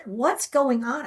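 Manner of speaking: high, slowed bewildered question; retold freak-out. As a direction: demand an explanation in confusion. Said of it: "I'm freaking out".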